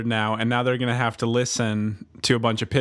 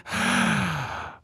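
seductive gasp; 10 semitones higher than usual. Pretend.

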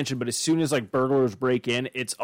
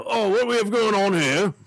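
higher mock English policeman accent; mock English bobby; 6 semitones higher, 3 dB louder than usual. English bobby to burglars, in a hammed-up English accent. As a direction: play a cheery English constable, a touch pompous. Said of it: "the bobbies from England".

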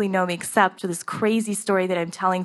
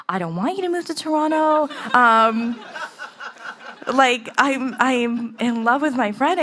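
tearful confession; 6 semitones higher, 5 dB louder than usual. Retold or imitated. Crying in bed with her.

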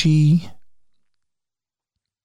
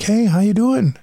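cheery EMT greeting; 10 semitones higher than usual. Retold or imitated. EMTs walking over to the patient, a cheery greeting. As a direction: greet him like an upbeat medic.

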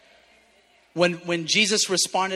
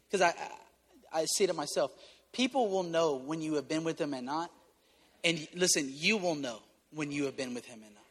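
softer, lower and gentle; gently; 5 semitones lower, 5 dB quieter than usual. Real, not acted. Pastor confessing gently to the church, soft and low. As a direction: speak it tenderly, as a confession. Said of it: "Can I say something gently?".

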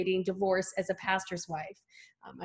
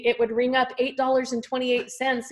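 usual speaking voice

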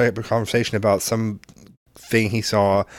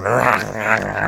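imitated bear snarl; much slower than usual. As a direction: growl like an irritated bear, playfully. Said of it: "and snarling at you".

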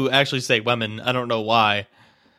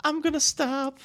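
falsetto; 11 semitones higher, 5 dB quieter than usual. Real, not acted. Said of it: "In falsetto".

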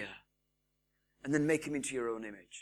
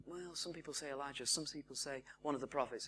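hushed retold answer; 3 semitones lower, 12 dB quieter, faster than usual. Disciples answer, retold quietly.

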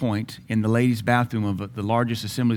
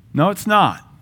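retold protest; 7 semitones higher, 5 dB louder than usual. Retold denial to God.

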